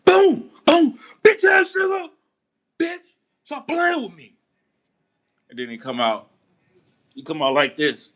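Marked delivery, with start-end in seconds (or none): 0.0-4.3 high, much louder yelled threat, voice cracking; hammed-up rage at a guy in the bathroom; mock furious yell. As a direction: imitate an angry shout that squeaks up high. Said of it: "He's like".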